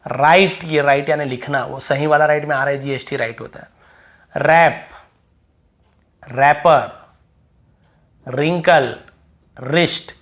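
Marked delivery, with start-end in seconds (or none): 0.0-0.6 राइट starting straight on R; silent W. Shown as a demonstration. Teacher models write for students, silent W. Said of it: "डब्ल्यू को नहीं पढ़ना". 4.4-10.2 रैप, रैपर, रिंकल, रिस्ट each starting on R; silent W. Shown as a demonstration. Teacher models the WR word list, silent W. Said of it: "डब्ल्यू को नहीं पढ़ना".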